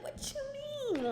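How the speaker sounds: high, soft, slow what you mean; hushed high incredulous question; mock disbelief. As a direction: squeak out the disbelief. Mocking having no manager.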